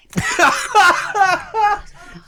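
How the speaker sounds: high cackling burst of laughter; loud laugh. Real, not acted. Mocking a streamer with co-host, high loud laughter.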